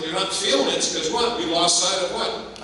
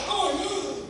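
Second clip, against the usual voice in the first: put-on kids cheering; 14 semitones higher, 3 dB quieter than usual. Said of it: "they shout".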